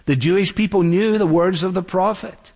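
normal talking voice

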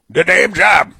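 mocking repeat; mock echo of the clip. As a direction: throw his words back with scorn. Mocking the clip's phrase back.

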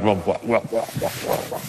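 low, fading stammer of "well"; flustered stammer. Acted. Preacher voices a listener outdone by a donkey, flustered stammer. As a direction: sputter softly, trailing into near-silence. Acted speechless reaction to being outdone.

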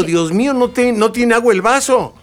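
hammed-up panic over the empty glass; mock childhood panic. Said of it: "me angustio horriblemente como niño".

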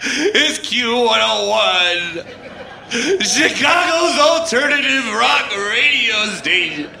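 slow station ID cracking with laughter and crying; laughing through tears. Real, not acted. Dumped DJ on air, tearful laughing station ID. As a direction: push the station name out while falling apart.

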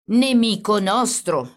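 slow, emphatic irritation; growing angry. A narrator's reading. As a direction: spell it out angrily, word by word. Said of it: "spiegò il carabiniere adirandosi".

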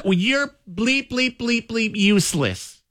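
high, slow mock angry mom. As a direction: imitate mom cursing at Alexa, high. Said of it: "she gets so angry".

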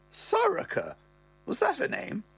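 high falsetto mother; mother's voice. Retold or imitated. Mother questioning the wife's name, high falsetto. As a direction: query it as the mum, high.

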